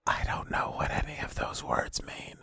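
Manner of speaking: breathy aside, barely voiced; whisper. Real, not acted. Friend whispers confused aside about anime titles. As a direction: whisper it like a confession.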